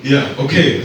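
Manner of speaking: ordinary talk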